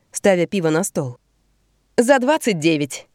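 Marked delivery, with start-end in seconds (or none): 2.0-3.1 raised toast, a bit higher. Acted. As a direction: proclaim it like a toast. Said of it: "провозглашает Беа".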